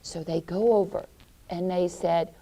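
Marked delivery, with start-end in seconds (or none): none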